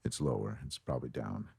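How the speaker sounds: low, quiet feeling-out of a deep voice; finding low pitch. Shown as a demonstration. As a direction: drop far down, soft and low. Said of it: "after I go pitch".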